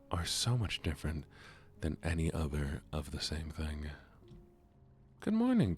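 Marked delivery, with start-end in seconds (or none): 5.2-5.8 greeting sung out very high and louder; high sing-song greeting. Real, not acted. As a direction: chirp it high like a bright cheery hello. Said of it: "are you asking me about the lovely or the morning?".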